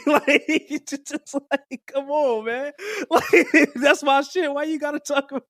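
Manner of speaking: high, squeaky laughing disbelief; can hardly breathe from laughing; wheezing giggle. Real, not acted. Laughing hard at the line.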